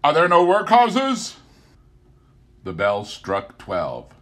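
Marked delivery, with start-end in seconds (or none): 0.0-1.4 high, loud bitter spirit; spirit mocking Scrooge. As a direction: throw his words back at him. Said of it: "turning on him ... his own words".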